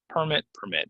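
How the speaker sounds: "permit" as PER-mit, then per-MIT; contrasting stress. Shown as a demonstration. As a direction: move the stress from first to second syllable of "permit". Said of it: "it goes on the first".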